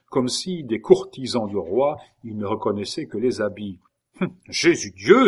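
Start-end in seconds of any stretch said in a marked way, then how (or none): 4.2-5.3 startled cry of Jesus God; the king cries out in shock; exclaiming. A narrator's reading. King startled by his friend's state, exclaiming. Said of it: "s'écria Henri".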